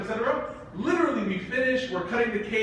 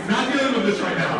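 laughing; 5 semitones higher, 6 dB louder than usual. Retold or imitated. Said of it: "I was like".

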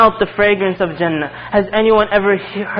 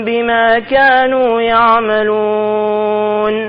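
higher, melodic chanted recitation; Quran recitation; 3 semitones higher, faster than usual. Acted. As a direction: recite the verse like tajweed.

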